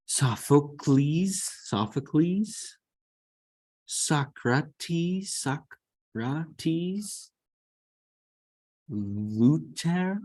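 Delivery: slow, high reading of Sophocles; slow sounding out. Shown as a demonstration. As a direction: decode it slowly letter by letter. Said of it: "sounding out Hebrew spelling".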